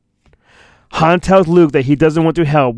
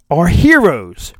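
plain reading voice

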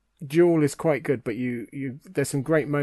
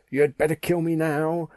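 higher mock-dramatic line reading; melodramatic quote; 4 semitones higher, 4 dB louder than usual. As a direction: deliver it as hammy melodrama. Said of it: "That's melodrama".

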